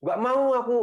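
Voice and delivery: put-on high, whining child refusing; high childish whine saying no; whiny refusal. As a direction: play the sulky kid who won't help. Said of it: "malah marah".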